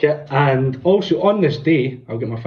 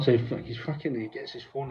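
ordinary talk, nothing marked

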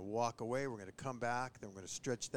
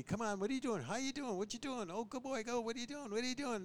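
put-on much higher, gushing baby talk to a dog; excited dog-greeting voice; 12 semitones higher, 3 dB louder than usual. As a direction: coo at the puppy like an overexcited owner.

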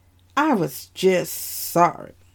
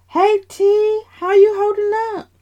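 much higher, louder quick greeting; cheerful brother; 12 semitones higher, 5 dB louder than usual. Acted. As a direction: brightly greet your sister in hospital. Brother greets injured sister.

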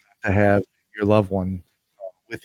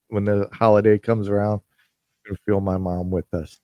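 normal talking voice

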